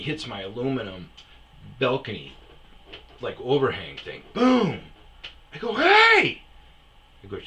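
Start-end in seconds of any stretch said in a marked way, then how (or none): 4.3-4.8 high, loud boom; imitated crash. 5.7-6.4 very high, loud hey; angry shout. Retold or imitated. Shouts at reversing driver.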